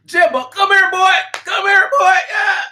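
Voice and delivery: high, loud hollering call; hammed-up master yelling for his servant; mock slave owner calling. Master calling Jimbo over, loud high mocking holler. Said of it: "the closest he'll ever be to a person is".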